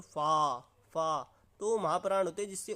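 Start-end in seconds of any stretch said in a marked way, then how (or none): 0.0-1.2 फा said with a strong puff of breath; aspirated demo. Shown as a demonstration. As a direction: push extra air through फा. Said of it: "अब मैं ऐसे बोलूँ". (फा को ज़ोरदार साँस के साथ बोलना)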